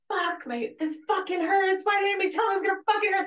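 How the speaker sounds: mock Scottish accent, cursing through labor pain. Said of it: "This, in her Scottish accent".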